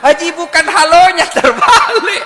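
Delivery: very high, loud comic outburst; exasperated shouting. Retold or imitated. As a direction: shout the correction at a clueless caller. Retold exasperated shout at caller. (teriakan gemas, melengking dan keras)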